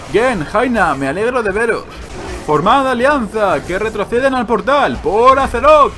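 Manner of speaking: high, loud commander's oratory; heroic king. Acted. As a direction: build to a rousing war cry.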